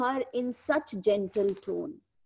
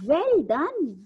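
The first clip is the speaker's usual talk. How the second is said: affectionate praise; 6 semitones higher, 4 dB louder than usual. A narrator's reading. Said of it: "the teacher patted her affectionately".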